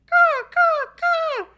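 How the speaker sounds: high, loud crow cawing; the crow answers; imitated crow caws. Retelling the crow's reply, high cawing. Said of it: "And then he said".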